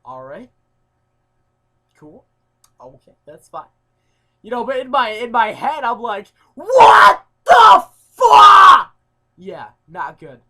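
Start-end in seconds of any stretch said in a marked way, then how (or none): 0.0-3.7 quietly holding it together, a bit softer; carefully calm acceptance; forced calm. Retold or imitated. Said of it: "just trying to be all calm about it". 6.5-8.9 slow, drawn-out scream, much higher and far louder; screamed, stretched-out outrage; inner outburst. Retold or imitated. Inner reaction to eviction.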